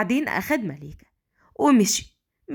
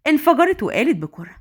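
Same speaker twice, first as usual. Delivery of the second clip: ordinary delivery, nothing marked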